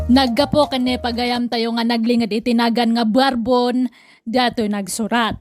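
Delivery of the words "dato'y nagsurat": ordinary talk, nothing marked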